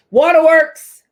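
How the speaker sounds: title called out high and loud; excited announcement. Acted. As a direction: announce it like a show segment. Announcing next tip title.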